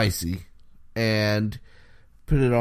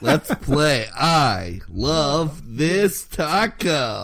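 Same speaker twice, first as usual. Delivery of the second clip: game show host; 4 semitones higher, 3 dB louder, much slower than usual. Pretend. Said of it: "You sounded like a game show host".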